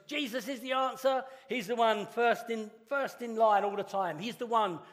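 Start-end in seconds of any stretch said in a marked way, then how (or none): 0.1-1.2 high-pitched imitation of an eager child; keen Sunday-school kid. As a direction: pipe up like a kid who always answers. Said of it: "he's the person in kids' work".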